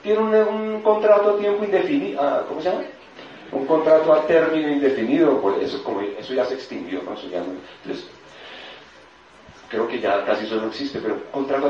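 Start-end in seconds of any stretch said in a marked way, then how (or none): 0.0-5.4 high-pitched gossiping disbelief; scandalized coworkers. Acted. Coworkers gossip about a colleague resigning, scandalized. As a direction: squeak it out, shocked at the news. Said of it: "todo el mundo en esa empresa".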